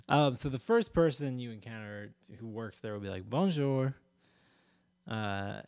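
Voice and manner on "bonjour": French greeting called out high and loud; lightly hammed-up French accent. Fair worker greets an arriving visitor, mock French accent. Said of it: "with a French accent".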